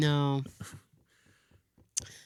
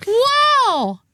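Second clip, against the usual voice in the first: high, loud, slow fake wonder; mock amazement; 4 dB louder, much slower than usual. Mocking locals marvelling at an American, high and slow. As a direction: gush over it like a rare find.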